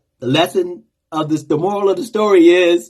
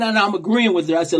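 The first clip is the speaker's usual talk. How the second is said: ordinary talk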